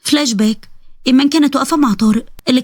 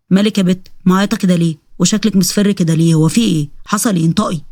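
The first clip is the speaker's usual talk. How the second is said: lower-pitched husband voice, questioning; lightly acted, demanding interrogation; suspicious husband; 4 semitones lower than usual. Husband questioning his tearful wife, low suspicious voice.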